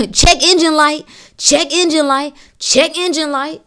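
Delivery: high, loud repeated alert; body's warning signal. Acted. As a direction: sound the alarm as the body, over and over. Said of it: "It's trying to say".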